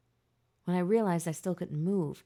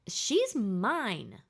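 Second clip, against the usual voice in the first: loud, higher possessive shriek; 4 semitones higher, 4 dB louder than usual. A narrator's reading. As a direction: scream it out as a claim. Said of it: "the blonde woman shrieked".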